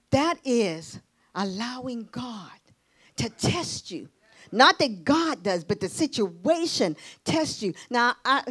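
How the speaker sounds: ordinary delivery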